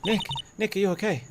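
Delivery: high, urgent worried cry; acted alarm. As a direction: call out anxiously to a hurt friend. Character checks on mauled friend.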